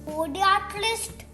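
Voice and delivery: ordinary delivery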